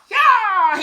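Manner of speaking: big, high, loud battle yell; shout. Acted. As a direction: let out a full-voiced yell as he leaps on. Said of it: "And with a shout".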